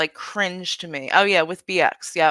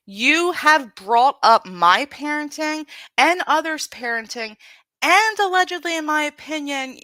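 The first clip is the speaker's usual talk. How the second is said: angry accusation; 6 semitones higher, 5 dB louder than usual. Real, not acted.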